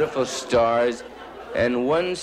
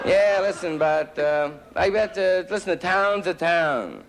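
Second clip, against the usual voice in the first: put-on higher, earnest old man voice; old man's wisdom; 8 semitones higher than usual. Old man tells comic the town's a town, earnest higher voice.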